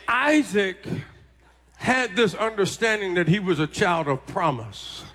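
usual speaking voice

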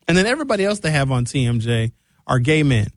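ordinary talk, nothing marked

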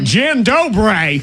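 high, slow, loud hammed-up greeting; mock greeting. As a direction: stretch the greeting out, beaming. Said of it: "And he's like".

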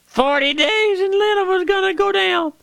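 long, high, held prophet's cry; Jonah crying out. Retold or imitated. Preacher voices Jonah crying warning through Nineveh in a long high cry. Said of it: "Jonah went through Nineveh crying".

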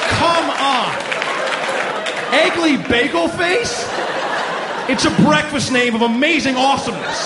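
can't believe the name exists, shouting with glee; incredulous delight. Real, not acted. Comic marvelling at a ridiculous name, shouting with glee.

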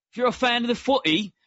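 bad, fast British accent, pitched high; hammy British accent on a quick aside. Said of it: "There's a terrible British accent".

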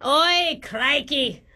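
broad mock-Aussie exclamation; hammed-up fake Australian; put-on Australian accent. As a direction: switch into a cartoon Aussie accent. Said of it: "change your accent".

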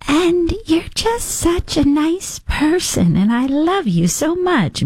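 high, slow, gushing voice; imitating gushing interviewer. Host mocking interviewer praising Meghan Markle, high gush. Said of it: "she talks like this".

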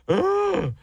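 high gasp into a disgusted ugh; mock retch at bad food; disgusted gag. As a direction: recoil with a strangled ugh. Acting out disgust at vegetables.

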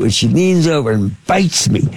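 usual speaking voice

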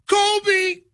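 very high, drawn-out yell; shooter's call-out. Acted. Shooting paper at the garbage, high shouted call. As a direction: holler it like calling your shot.

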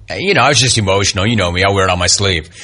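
low, quick, offhand imitation; mumbled-off excuse, imitating him mockingly; mock excuse. Mocks player's emotional excuse.

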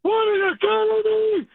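high, drawn-out holler down the phone; incredulous caller blowing up; real outraged yell. Real, not acted. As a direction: yell it at full stretch, voice way up. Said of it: "He yelled so loud".

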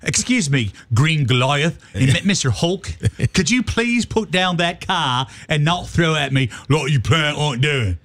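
put-on slowed British accent slipping toward Cockney; muddled mock British. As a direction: plead politely in a wobbly fake British accent. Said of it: "I don't know what your accent is turned into".

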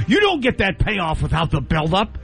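ordinary talk

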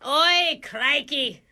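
sudden broad mock-Aussie; put-on Australian accent. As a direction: switch to hammed-up Australian.

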